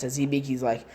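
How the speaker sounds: ordinary delivery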